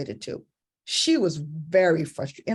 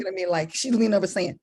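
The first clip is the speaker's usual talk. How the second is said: ordinary talk, nothing marked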